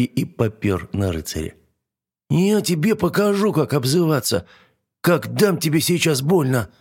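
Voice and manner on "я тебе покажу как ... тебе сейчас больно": higher, squeaky threat; squeaky dragon. A narrator's reading. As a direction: threaten like a tiny angry dragon. Dragon charges knight.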